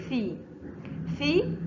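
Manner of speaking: ثي with the tongue tip out between the teeth, voiceless th. Shown as a demonstration. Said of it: "إخراج اللسان عند النطق".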